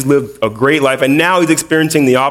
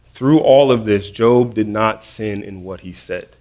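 ordinary talk, nothing marked

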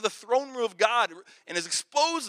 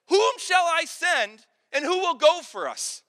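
slow, loud question; 7 semitones higher, 7 dB louder, slower than usual. Acted. Father asks the council.

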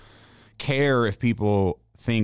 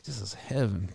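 smooth talker; 8 dB quieter than usual. Retold or imitated. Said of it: "Like, rubbing their chest".

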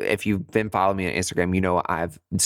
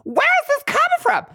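high, louder burst of frustration; acted-out inner outburst, pitched way up; exasperated inner dialogue; 4 dB louder than usual. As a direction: blurt it out high, arguing with your own mind. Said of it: "this is my inner dialogue".

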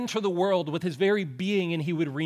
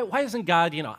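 usual speaking voice

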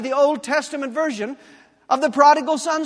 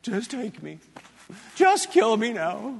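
pathetic whine; 2 semitones lower than usual. Retold or imitated. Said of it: "It is pathetic".

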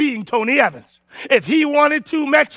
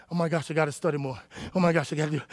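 soft, fast, lower panicked muttering; 7 semitones lower, 10 dB quieter, much faster than usual. Retold or imitated. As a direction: rush it under your breath, scared. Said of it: "I'm shaking in my boots".

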